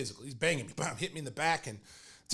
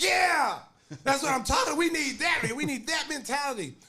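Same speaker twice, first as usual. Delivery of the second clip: high, loud gleeful shouting; retold excited cheering; 10 semitones higher, 5 dB louder than usual. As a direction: imitate a fired-up coach roaring approval. Coach cheering from the back.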